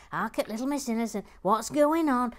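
hammed-up camp tutting; camp mock indignation. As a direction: sneer it like a camp gossip. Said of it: "Gary Blair says".

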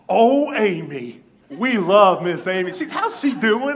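high, loud, slow gushing; delighted gush. Retold or imitated. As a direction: gush with joy about Amy. Cashier lights up, retold.

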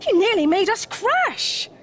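high, loud startled complaint; flustered storybook penguins; alarmed gasp. A narrator's reading. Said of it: "gasped the penguins".

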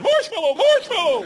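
very high, loud mock scream for help; hammed-up panicked yelling of the safe word; panicked shout. Man being thrown about by a bear, panicked shout.

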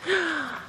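a sharp, soft gasp of delight. Real, not acted. Spotting her pig Hamilton, a delighted gasp.

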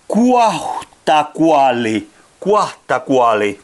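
"kuahtakuali" said slowly, with a breathy h closing "kuah"; careful Nahuatl pronunciation. Shown as a demonstration. Teacher attempting a hard Nahuatl word for the class, slow careful "kuahtakuali". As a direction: draw out "kuahtakuali" syllable by syllable. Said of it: "miten lausutaan tämä".

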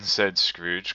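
plain reading voice

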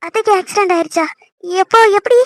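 startled, urgent demand for news; shocked questioning. Acted. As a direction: blurt it out in shock. Hears of aunt's accident.

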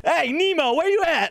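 high, carrying call; searching shout to someone far off. Acted. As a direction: holler across the water. Calling out to swimmer.

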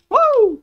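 short high-pitched "woo"; high whoop. Real, not acted. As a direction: let out a high game whoop. Whoop while playing.

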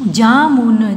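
Jamun stretched into separate sounds; syllable by syllable. Shown as a demonstration. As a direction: hold each syllable of jamun. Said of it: "फिर ये सारे बोलने". (जामुन को तोड़-तोड़ कर)

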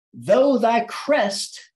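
slow, ringing poetic address; drawn-out, high-flown recitation; grand declamation. Acted. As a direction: declaim it grandly, stretching each word. Declaiming the poem to the bird.

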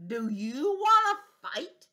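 slow, loud taunting challenge; slow bossy bug voice; grouchy challenge. Acted. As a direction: drag out the dare. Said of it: "grouchy challenge".

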